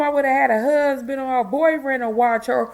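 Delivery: usual speaking voice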